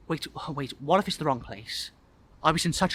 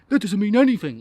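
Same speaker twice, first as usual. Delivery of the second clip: higher, louder, assured lad; confident boy; 6 semitones higher, 7 dB louder than usual. A narrator's reading. Boy urging shy guest, confident voice. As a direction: dismiss the excuse with confidence. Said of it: "he confidently replies".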